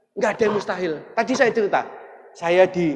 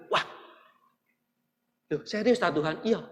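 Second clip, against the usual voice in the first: usual speaking voice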